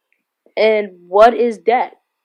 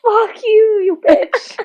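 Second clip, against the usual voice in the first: mock caller yell; 13 semitones higher, much slower than usual. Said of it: "repeating the caller's yell".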